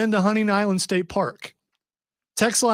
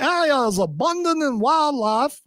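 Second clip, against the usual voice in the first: a Southern accent, raised and refined; genteel Southern accent; 4 semitones higher than usual. Shown as a demonstration. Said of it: "Should have read it in that accent".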